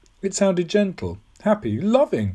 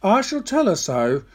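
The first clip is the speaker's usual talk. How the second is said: roused, indignant; 5 semitones higher, 3 dB louder than usual. A narrator's reading. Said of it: "Fully roused against Missus Bartholomew".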